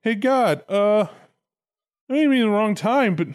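a lightly voiced sulky Adam griping to God; Adam whining that God stole his girl; whiny Adam. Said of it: "He's got Adam going".

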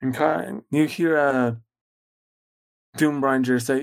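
normal talking voice

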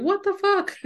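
high, incredulous mock outburst; imitating the panicked part of you; mock freak-out. Inner voice reacting to a shut-off notice, mock shock. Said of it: "part of you that's going".

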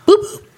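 very high, loud beep-beep of a key fob; high chirpy electronic beep noise; imitated car beep. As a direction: mimic the car unlocking.

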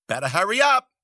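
high, loud yell. Acted. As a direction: yell it across the road. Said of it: "Zoot yelled".

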